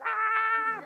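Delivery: high, loud yell of discovery; late-night editor yelling with excitement; retold excited yell. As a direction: shout out at the breakthrough. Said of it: "I remember yelling".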